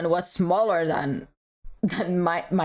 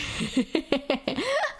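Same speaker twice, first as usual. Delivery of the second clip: soft high laugh; short amused giggle; 7 semitones higher, 3 dB quieter than usual. Real, not acted. Streamer giggling at a chat comment, soft high laugh.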